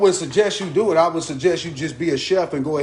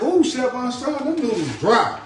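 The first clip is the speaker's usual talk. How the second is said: mock viewer complaint; 7 semitones higher than usual. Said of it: "I know y'all like".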